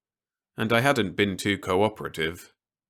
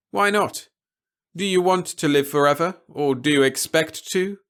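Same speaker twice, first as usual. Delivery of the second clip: a lightly raised, measured officer's voice; stern officer; 9 semitones higher, 6 dB louder than usual. A narrator's reading. Officer warning Peter against anything rash, lightly voiced and grave.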